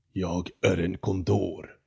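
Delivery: a slow, deep, hoarse bird voice drawn out; deep hoarse condor. Acted. The condor in the tree answers the startled Jonathan, slow and hoarse. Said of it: "ekade en djup, hes röst".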